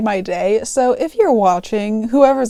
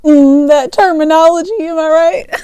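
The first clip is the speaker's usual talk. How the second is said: louder, higher mock-impressed delivery; hammed-up aside; 6 semitones higher, 8 dB louder than usual. Joking to viewers about the cashier job title, hammed-up. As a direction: play up the fancy wording as a joke.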